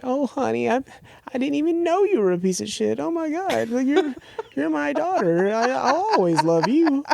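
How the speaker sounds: high-pitched, put-on Canadian mom voice; super Canadian mom. Canadian mom forgiving her daughter, high hammed-up imitation. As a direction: gush it like a hammed-up Canadian mother. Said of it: "super Canadian".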